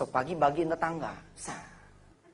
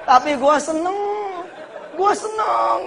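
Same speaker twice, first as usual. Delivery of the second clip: joyful mother; 12 semitones higher, 6 dB louder, slower than usual. Retold or imitated. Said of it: "dia ngomong".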